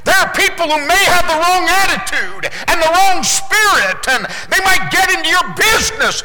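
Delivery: rally the congregation with rising fire; preaching at full force. Acted. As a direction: revival preacher calling out. Preaching climax.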